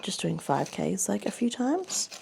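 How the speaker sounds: ordinary delivery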